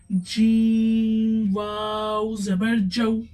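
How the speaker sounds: drawn-out Qur'anic spelling of जीम वाव जबर जऊ; slow spelled-out reading. Shown as a demonstration. Teacher demonstrating the spelling to students, slow and careful. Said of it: "तो देखिए".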